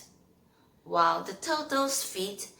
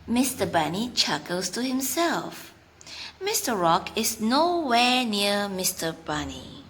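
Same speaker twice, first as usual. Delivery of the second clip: put-on 1 story reading for kids; 6 dB louder than usual. Reads a story to children.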